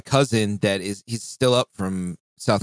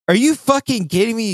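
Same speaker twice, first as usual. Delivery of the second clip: high, louder mock disbelief; incredulous outburst; 14 semitones higher, 4 dB louder than usual. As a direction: replay your shock at the coworker, pitched way up. Retold disbelief at coworker's marinating.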